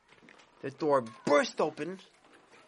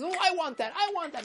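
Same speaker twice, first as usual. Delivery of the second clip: much higher, wheedling craving voice; greedy Yetzer Hara; 12 semitones higher than usual. Acted. The Yetzer Hara in the heart, a high greedy wheedle. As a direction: squeal out the wanting like a spoiled child. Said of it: "The Yetzer Hara says".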